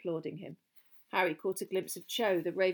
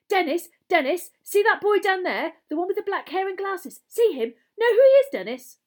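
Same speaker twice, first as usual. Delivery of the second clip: high, loud, eager schoolboy; excited; 12 semitones higher, 13 dB louder than usual. Acted. Boy points out a famous pupil to his brother, excited.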